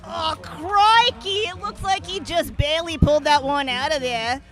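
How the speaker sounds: high, loud, slow mock Australian crikey; hammed-up Aussie exclaiming crikey; Australian accent. Co-host reacting to the run in mock Australian accent. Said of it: "try to do our Australian accents".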